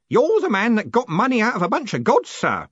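put-on high, loud, gleeful old Grote; happily grinning old man. A narrator's reading. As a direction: crow it happily through a grin. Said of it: "he said, grinning happily".

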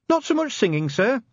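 very high-pitched junior officer voice; Cheery's report. Acted.

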